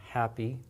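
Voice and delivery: "happy" with a light, airy English h; relaxed, quiet "happy", no blast on the h. Shown as a demonstration. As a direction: let a little air out on the h of "happy", softly. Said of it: "A little bit of air comes out".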